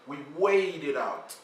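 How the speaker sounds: normal talking voice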